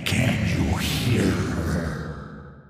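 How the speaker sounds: a sinister wraith savouring torment; cold hissing layered whisper. Acted. Thresh's voice.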